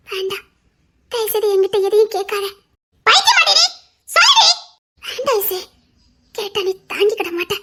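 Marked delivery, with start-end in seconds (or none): 3.1-4.5 high, loud demanding shout; frantic demanding yell; desperate shout. Acted. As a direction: yell at her to tell you. Friend demanding to be told.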